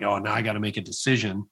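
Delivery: deflated, half to himself; dejected. Retold or imitated. Retelling hanging up on the moderator, quietly dejected.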